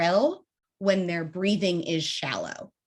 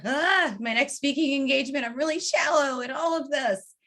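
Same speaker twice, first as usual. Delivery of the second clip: fretful self-talk; 7 semitones higher than usual. Retold or imitated. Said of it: "if you're sitting here going".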